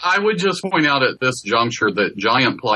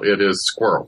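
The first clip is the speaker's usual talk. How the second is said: It is squirrel with squirrel as one Southern syllable, skwerl; Alabama squirrel; 4 semitones lower, faster than usual. Shown as a demonstration. Alabama speaker demonstrating her one-syllable squirrel. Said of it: "in Alabama, it is frequently one syllable".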